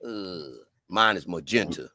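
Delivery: low-pitched mock-serious correction; deep voice. Shown as a demonstration. Host correcting co-host's pink to magenta, deep voice. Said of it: "say it with the deep voice".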